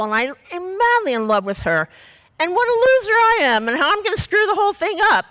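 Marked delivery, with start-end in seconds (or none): 2.4-5.3 high-pitched mock self-pity; retold angst. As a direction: recite your old insecurities in a high, fretful voice. Recites her angsty love letters.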